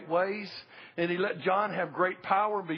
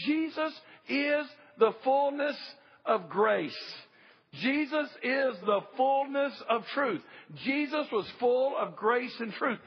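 preaching proclamation; 4 semitones higher, slower than usual. Acted. Preacher proclaims Jesus' fullness.

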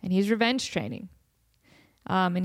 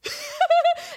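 squealing laugh; high shriek of laughter; 4 dB louder than usual. Real, not acted. Laughing at the story.

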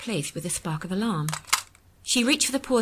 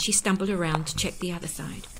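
ordinary delivery, nothing marked

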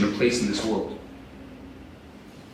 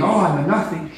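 ordinary talk, nothing marked

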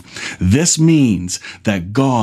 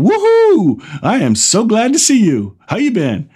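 joyful God voice; 9 semitones higher than usual. Pretend. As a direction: greet them like an overjoyed friend. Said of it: "It's like God saying".

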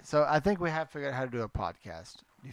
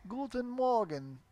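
slow, higher mock-Russian greeting; German with Russian accent; 7 semitones higher, 3 dB quieter than usual. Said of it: "German word spoken with a Russian accent".

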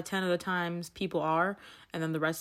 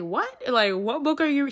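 shocked; 7 semitones higher, 6 dB louder than usual. Retold or imitated. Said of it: "I was like".

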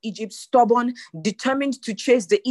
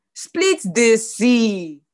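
louder, very drawn-out command; emphatic command; 5 dB louder, much slower than usual. Acted. Preacher voicing God's order to Moses, slow emphatic command. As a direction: stretch every word into a forceful order.

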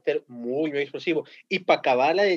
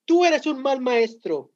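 imitating a student's angry yell, high and drawn out; slow, high-pitched mock scolding at a teacher; grito retado; 5 semitones higher, 3 dB louder, slower than usual. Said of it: "y le grita". (gritándole al maestro, imitación aguda y lenta)

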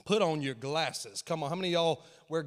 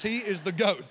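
usual speaking voice

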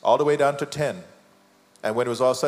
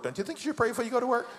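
laughing fast question; 10 semitones higher, 4 dB quieter, much faster than usual. Acted. Joking question, laughing, repeated later.

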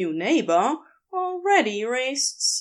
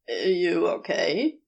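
slow, lower, gently concerned ask; 5 semitones lower, slower than usual. A narrator's reading. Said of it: "tone laced with concern".

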